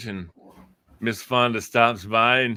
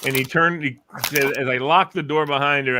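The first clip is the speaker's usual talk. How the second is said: normal talking voice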